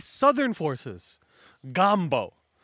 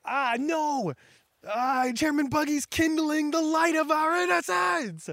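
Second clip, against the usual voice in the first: much higher, gushing voice of a starstruck underling; overwhelmed lackeys; 10 semitones higher than usual. Retold or imitated. Lackeys gushing over Chairman Buggy in high excitement. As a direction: swoon like a fan hearing their hero.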